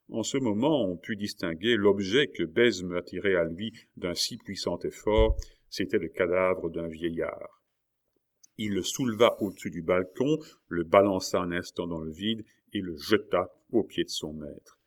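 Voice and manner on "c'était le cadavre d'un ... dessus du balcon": ordinary delivery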